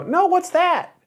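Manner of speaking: put-on very high, innocent little-kid voice; clueless kid. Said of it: "And then I go".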